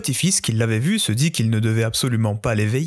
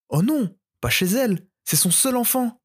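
dismayed cry; 10 semitones higher than usual. Acted. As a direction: gasp it out in dismay. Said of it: "s'écria une autre".

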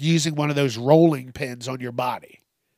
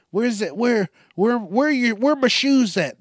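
much higher, rising frantic whine; high whiny searcher; 9 semitones higher, 3 dB louder than usual. Acted. Someone searching for lost shoes, high frantic whine. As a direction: whine like you can't find your shoes.

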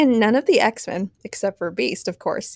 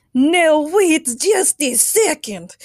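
very slow, loud booming call; mighty shout; 6 semitones higher, 6 dB louder, much slower than usual. A narrator's reading. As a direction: halt everyone with a mighty voice. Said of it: "shouted with a mighty voice".

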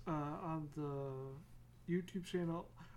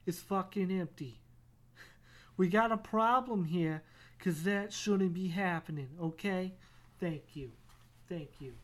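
demanding cat voice; 3 semitones higher, 6 dB louder than usual. Retold or imitated.

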